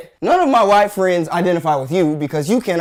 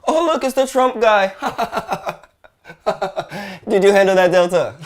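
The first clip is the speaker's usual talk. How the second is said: laughing; 3 semitones higher than usual. Real, not acted. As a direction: laugh at the Trump guy.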